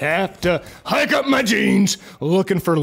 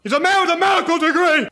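alarmed shout; 10 semitones higher, faster than usual. Acted.

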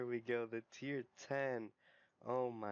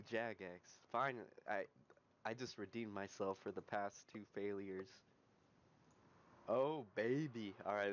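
ordinary talk

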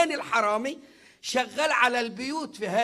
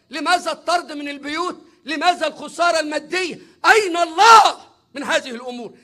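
louder climactic question; rhetorical shout; 3 semitones higher, 7 dB louder than usual. Real, not acted. Preacher shouting the skeptic's question at the hall. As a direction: hurl the question at the crowd.

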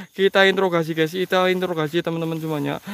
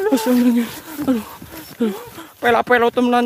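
usual speaking voice

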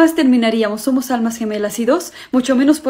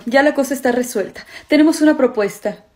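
ordinary talk, nothing marked

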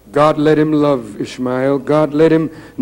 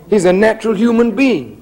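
normal talking voice

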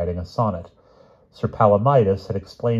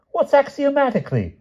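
very high, puzzled royal query; baffled king; 3 dB louder than usual. A narrator's reading. King puzzled by the knight's long word, high and baffled. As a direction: ask it in a high, bewildered voice.

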